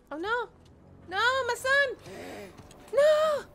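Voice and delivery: rising panicked cries; distressed shouting. Real, not acted. Son collapses in game.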